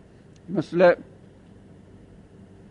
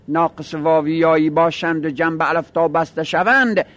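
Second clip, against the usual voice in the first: usual speaking voice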